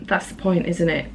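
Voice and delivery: ordinary delivery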